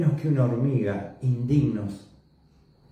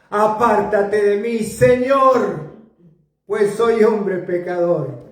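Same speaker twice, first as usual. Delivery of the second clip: fervent plea; 8 semitones higher, 4 dB louder, slower than usual. Acted. Preacher voicing Simon's plea.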